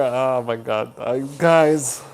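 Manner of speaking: ordinary delivery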